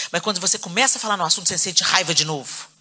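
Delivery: normal talking voice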